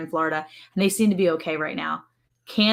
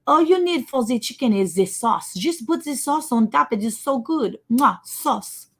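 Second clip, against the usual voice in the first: higher, louder mock French accent; terrible French accent; 5 semitones higher, 4 dB louder than usual. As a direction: imitate a French neighbor gushing, accent laid on thick. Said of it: "my terrible French accent".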